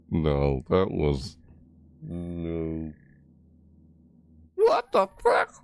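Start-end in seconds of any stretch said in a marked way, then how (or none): 4.6-5.5 sudden very high squeak; squeaky outburst. Real, not acted. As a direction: yelp it in falsetto.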